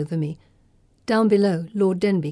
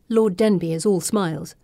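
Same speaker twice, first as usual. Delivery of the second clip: usual speaking voice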